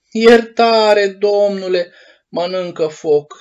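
loud, high desperate plea; pleading. A narrator's reading. Pinocchio begging the puppeteer for mercy, loud and high. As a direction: beg for mercy with a cry. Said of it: "rosti cu o voce rugătoare".

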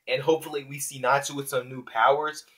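ordinary talk

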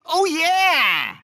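high, punchy celebration; excited whoop. Acted. Host celebrating the player's good run, excited. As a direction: whoop for the win.

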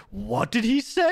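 high, slow mock-baffled chat voice; confused viewers. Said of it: "you guys were like".

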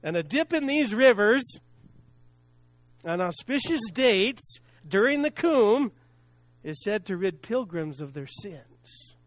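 usual speaking voice

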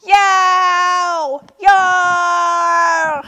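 high, loud drawn-out wails; screaming tantrum. A narrator's reading. Turtle throws a tantrum for the animals, screaming. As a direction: scream each cry long and high. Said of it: "screamed Turtle".

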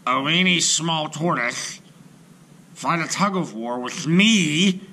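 loud, high scornful scoff; mocking hippo. A narrator's reading. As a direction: sneer at the tiny tortoise.

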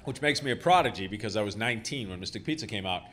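ordinary talk

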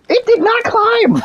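high, slow outraged shout; shouting disbelief. Real, not acted. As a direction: yell it in disbelief. Shouting disbelief at flying prowler.

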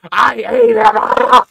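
slow, very loud, put-on villain voice; gloating mantis confession. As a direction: drag it out like a monster confessing.

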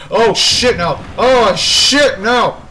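loud, high cries of dread; alarmed shouting. Real, not acted. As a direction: burst out as the threat moves in. Pursuer starts moving.

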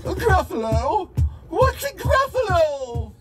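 loud, high startled question; startled fox. Acted. Fox startled by the word Gruffalo, loud. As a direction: yelp it out in surprise.

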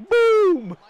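huge high-pitched BOOM; explosive shout. Real, not acted. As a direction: yell it like a bomb going off. Mimics the knockout kick.

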